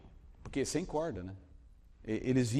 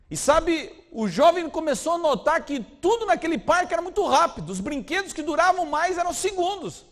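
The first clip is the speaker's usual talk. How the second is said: loud, high preacher build; rising sermon intensity, pushing harder; preacher ramping up to a loud climax; preaching at full force; 9 semitones higher, 7 dB louder than usual. Acted.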